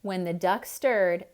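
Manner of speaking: ordinary delivery, nothing marked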